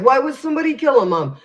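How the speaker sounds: reacting to mom's guess; incredulous. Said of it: "I was like".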